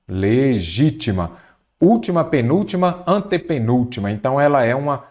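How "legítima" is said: "legítima" drawn out slowly, stressed on GÍ; syllable by syllable. Shown as a demonstration. Teacher finding the strong syllable of legítima, slowly sounded out. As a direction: stretch "legítima" so each syllable shows. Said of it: "vamos separar a palavra em sílaba".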